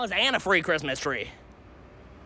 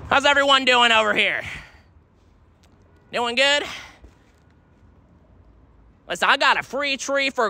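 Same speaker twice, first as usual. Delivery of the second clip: raised, upbeat call to a crowd; 4 semitones higher than usual. Real, not acted. Hawker calling to people at the bus stop, raised and upbeat.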